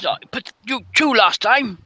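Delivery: high, indignant sailor's protest; arguing sailor. Acted. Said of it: "He goes".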